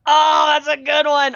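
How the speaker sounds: real high-pitched enthusiasm; excited recognition. Real, not acted. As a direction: burst out with delighted approval. Reacts to the film named.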